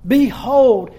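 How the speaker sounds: urgent call of Behold; emphatic behold. Shown as a demonstration. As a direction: land hard on Behold, then drop. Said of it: "This is a very emphatic phrase".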